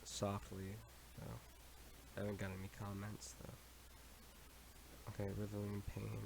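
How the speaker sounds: quietly to the mic; talking softly. Real, not acted. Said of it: "I'm sorry I'm talking very softly".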